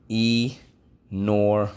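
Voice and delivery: Enormous drawn out very slowly; slow model. Shown as a demonstration. Teacher slowly modelling Enormous for students. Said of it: "What's the word?".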